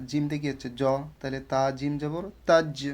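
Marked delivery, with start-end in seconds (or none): 2.4-2.9 তাজ with a bouncing echo on the final j; qalqalah echo. Shown as a demonstration. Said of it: "এখানে কলকলার হরফ তাজ". (তাজ-এর জ ইকো করে)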